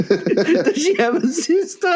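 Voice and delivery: high, drawn-out mock voice; mocking quote. Teasing friend with his own words, high mocking quote. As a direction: squeal it slowly through laughter. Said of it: "That's what you said".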